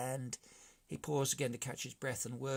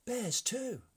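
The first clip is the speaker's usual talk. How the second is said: much higher squeaky brigand chorus; brigands' voice; 12 semitones higher than usual. A narrator's reading. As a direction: chime in, high. Brigands chiming in.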